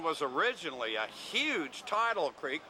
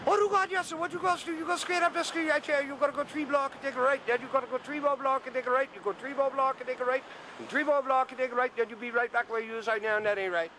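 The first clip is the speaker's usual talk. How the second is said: put-on a higher-pitched Gullah voice of an old gardener; Gullah accent; 5 semitones higher, faster than usual. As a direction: put on a full Gullah accent, amused and polite.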